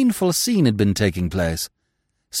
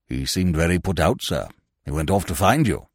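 lightly voiced low servant; a hint of a cool attendant; reserved attendant; 5 semitones lower than usual. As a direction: report it with deference. Attendant continues his report.

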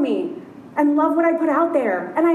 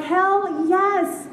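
enthusiastic cheer; 6 semitones higher than usual. Retold or imitated. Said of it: "I'm like".

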